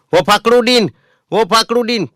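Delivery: high, loud call across the crowd; hollering a friend's name; man yelling for his friend to sit. Retold or imitated. Man calls friend across bus.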